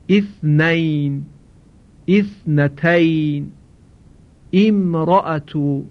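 slow, careful Arabic reading of اثنین، اثنتین، امرأة; Arabic example words. Shown as a demonstration. Teacher slowly models Arabic words for the class, careful and clear. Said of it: "مثل کلمهٔ مثلاً".

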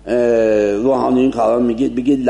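ordinary delivery

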